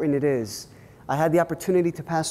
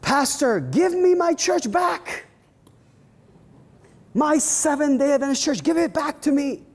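indignant church lady; 10 semitones higher than usual. Retold or imitated. Church lady writing to the pastor, high indignant demand. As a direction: whine it like an affronted member. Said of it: "she wrote me this email and said".

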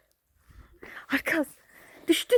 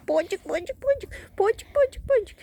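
high pet call; 11 semitones higher than usual. Real, not acted. Calling the pet.

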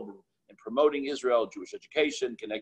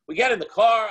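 usual speaking voice

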